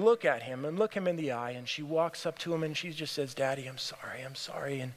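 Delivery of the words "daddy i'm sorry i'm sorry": hushed, sinking apology; quiet apology. Acted. Daughter walking up to her father, quietly apologetic. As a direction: say sorry softly, nearly under your breath.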